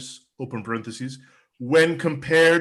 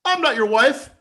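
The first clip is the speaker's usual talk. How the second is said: high, louder screamed quote; mock scream; 10 semitones higher, 5 dB louder than usual. Comic quoting wife who denies being his wife, mock scream. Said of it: "when your wife screams".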